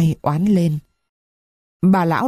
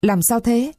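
a touch of alarm, pitched higher; startled girl asking what's wrong; frightened, hurried concern; panicked question; 5 semitones higher than usual. A narrator's reading. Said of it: "Sâm hốt hoảng".

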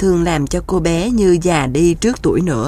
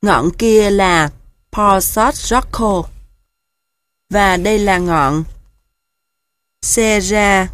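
put-on old geese; slower than usual. A narrator's reading. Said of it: "Các ngỗng già không ngớt mồm".

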